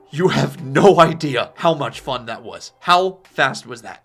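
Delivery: loud, slow, emphatic thrill; exhilarated. Acted. Barry gushes to Silas after his run, exhilarated. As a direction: stretch the words out with loud excitement.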